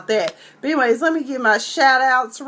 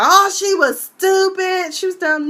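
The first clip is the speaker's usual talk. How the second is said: mocking critics; 9 semitones higher, 4 dB louder than usual. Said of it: "some people are".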